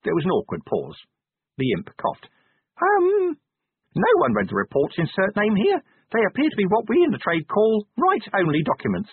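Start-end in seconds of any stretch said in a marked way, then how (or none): 2.8-9.1 very high-pitched little imp, awkwardly explaining; squeaky-high imp with a hesitant start. Acted. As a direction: break awkward news as a small officious creature. Imp admits an awkward truth.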